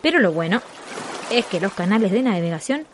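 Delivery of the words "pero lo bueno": ordinary talk, nothing marked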